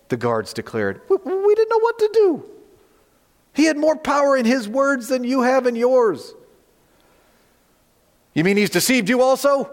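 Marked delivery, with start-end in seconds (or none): 1.1-2.5 high-pitched, stammering mock helpless voice; flustered guards. Guards excusing themselves to the Pharisees, high and flustered. 3.5-6.3 lightly acted guard, pitched high; protesting guards. Guards answering the chief priests, high-pitched mild protest. 8.3-9.7 higher, incredulous Pharisee's question; retort. Acted. Pharisees challenging the temple guards, higher-pitched retort. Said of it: "the Pharisees retorted".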